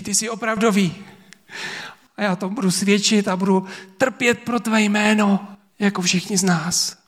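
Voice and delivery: high, impassioned voice of Thomas; fervent disciple. Acted. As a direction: pour it out as an overwhelmed believer. Thomas's fervent confession acted out.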